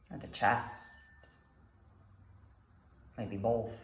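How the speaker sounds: calm meditation guide, long pauses between phrases; guided meditation. Acted. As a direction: guide them gently through the breath, unhurried.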